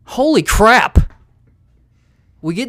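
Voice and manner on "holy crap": slow, loud, much higher holy crap; shocked. Real, not acted. Host reacting to grim news, loud stunned exclamation. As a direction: blurt it out in real shock.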